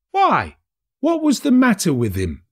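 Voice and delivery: high, loud surprised question; a startled snuff-taking merchant. A narrator's reading. Third merchant asks.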